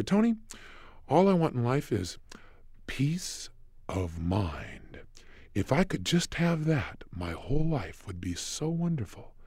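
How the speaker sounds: wistful longing. Retold or imitated. Client wistful for peace of mind.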